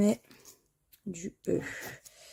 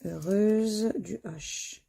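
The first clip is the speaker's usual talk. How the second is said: ordinary delivery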